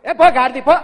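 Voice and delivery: loud angry shout at son; scolding shout. Retold or imitated. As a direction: bark him away loudly. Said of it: "కొడుకుతోనేమో అట్లా మాట్లాడతారు". (కొడుకుని గట్టిగా తిట్టే అరుపు)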